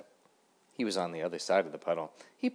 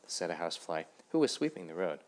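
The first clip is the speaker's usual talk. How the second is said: plain reading voice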